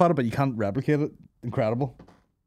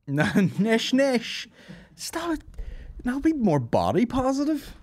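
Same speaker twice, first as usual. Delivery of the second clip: mock scolding; 12 semitones higher than usual. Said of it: "mock telling off".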